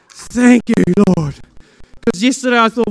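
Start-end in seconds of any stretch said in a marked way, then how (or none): 0.1-1.4 loud grateful thanks. Retold or imitated. Crying out thanks to God, loud. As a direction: cry it out with relief. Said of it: "I'm like".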